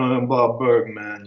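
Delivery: lightly voiced Brooklyn tough guy; a hint of cool, low-pitched toughness. Warm guy keeping up a cold exterior, lightly tough. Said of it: "keep that Brooklyn exterior".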